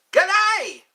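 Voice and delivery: a high, loud, cheery G'day; excited greeting. Real, not acted. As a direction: call out the hello brightly. Greeting a returning viewer.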